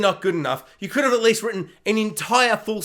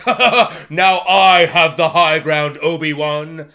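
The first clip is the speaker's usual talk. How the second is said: slow, louder gloating villain voice; mock Vader gloat; 5 semitones lower, 6 dB louder, slower than usual. Vader gloating over Obi-Wan, slow mock villain. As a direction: gloat over him slowly. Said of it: "he's like".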